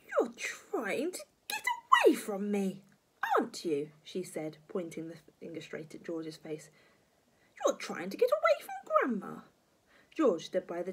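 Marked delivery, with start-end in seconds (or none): 0.1-3.9 accusing grandma. Acted. Grandma accusing George menacingly. 7.7-9.4 very high, loud granny accusation; pitched-up menacing old woman; accusing grandma. Acted.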